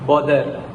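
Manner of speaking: "bother" with a hard D for the TH; Filipino pronunciation. Shown as a demonstration. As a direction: say "bother" as "bo-der". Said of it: "In Filipino language".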